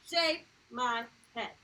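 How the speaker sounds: teacher showing capital-letter emphasis. Shown as a demonstration. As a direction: hit each word of Shave my head.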